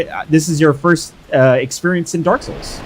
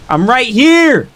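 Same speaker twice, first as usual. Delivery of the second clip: high, loud, stretched-out dare; goading battle holler; taunting shout; 8 semitones higher, 4 dB louder, slower than usual. Real, not acted. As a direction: yell at the enemy to come at you. Taunting the game boss to attack.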